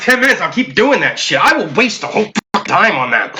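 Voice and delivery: ordinary talk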